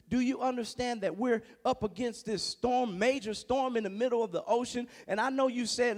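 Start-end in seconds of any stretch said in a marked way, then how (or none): none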